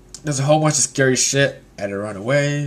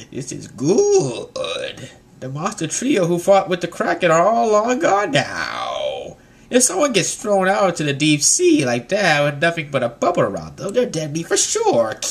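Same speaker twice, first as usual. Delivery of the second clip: gloating Koribu; 4 semitones higher than usual. Retold or imitated. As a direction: stretch the words with creepy relish. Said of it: "And Koribu's like".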